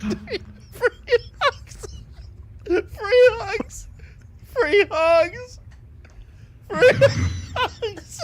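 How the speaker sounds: squealing through laughter; laughing too hard. Real, not acted. Healing a teammate but laughing too hard. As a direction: choke the words out mid-laugh. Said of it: "trying to utter out free hugs, but I was laughing too hard".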